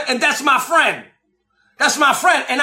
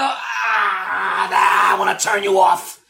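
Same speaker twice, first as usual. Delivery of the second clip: vexed groan; 5 semitones lower, slower than usual. Retold or imitated. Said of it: "Something inside vexes me and I go".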